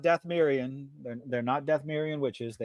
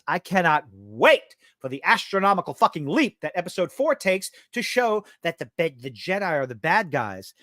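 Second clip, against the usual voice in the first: normal talking voice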